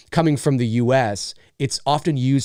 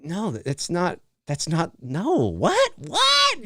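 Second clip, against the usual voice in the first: mock confused protest; 4 semitones higher, much slower than usual. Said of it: "where you're like".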